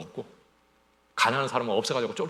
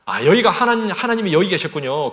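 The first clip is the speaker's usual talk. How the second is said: loud, high cry of discovery; awed exclamation; 6 semitones higher, 12 dB louder than usual. Acted. Jacob realizing God is here, loud awed cry. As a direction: call it out, stunned by the holy place.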